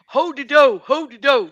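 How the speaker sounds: hammed-up giant's single word; Hodor impression. Recalling Hodor from Game of Thrones, loud mock chant. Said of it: "reminded me of ... Game of Thrones".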